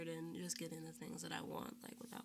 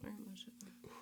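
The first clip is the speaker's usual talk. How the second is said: whisper; 9 dB quieter than usual. Real, not acted. Whispered agreement.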